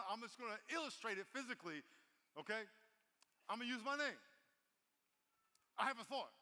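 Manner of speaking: usual speaking voice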